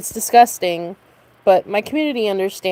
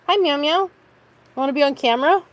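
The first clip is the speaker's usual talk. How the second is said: pet talk; 9 semitones higher, 3 dB quieter than usual. Real, not acted. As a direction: coo up high to coax the kitty. Talking to the pet cat.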